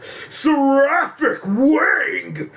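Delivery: high drawn-out attack cry; booming demon lord. Pretend. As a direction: scream the move name slowly. Special move shout.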